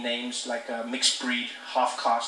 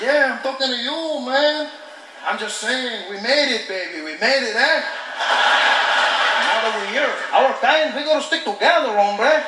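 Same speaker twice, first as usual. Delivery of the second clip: put-on high, loud Spanish with a Mexican accent; loud mock Mexican accent greeting; 7 semitones higher, 5 dB louder than usual. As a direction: greet a fellow Mexican loudly. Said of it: "He said".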